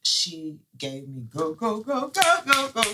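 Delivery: ordinary talk, nothing marked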